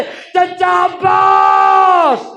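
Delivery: a long, drawn-out cry of the name, high and loud; wailing cry. A narrator's reading. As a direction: wail for the lost uncle from the prison. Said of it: "हम जिंदानों में रो रो के कहते थे". (रोते हुए लंबी पुकार)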